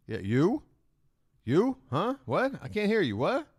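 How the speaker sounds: cup an ear and play deaf, hammy; pretending not to hear. Mocking bidder with raised hand.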